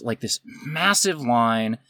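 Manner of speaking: ordinary talk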